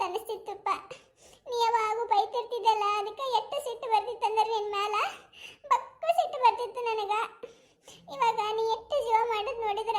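put-on a young daughter half laughing, half crying to her father; tearful daughter. Daughter tells her father she used to cry, tearful and choked. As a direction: talk through tears, choking up now and then.

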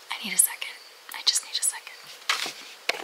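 barely audible, overwhelmed whisper; spent whisper. Real, not acted. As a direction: whisper it, needing a moment to recover. Spent after rant, needs moment.

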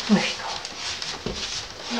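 breathy slow whisper. Real, not acted. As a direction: hush it out slowly. Whispering to the dog.

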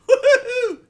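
high, loud whoop of victory; triumphant whoop. Real, not acted. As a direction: cheer like you just escaped. Celebrating a successful extraction.

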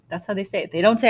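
usual speaking voice

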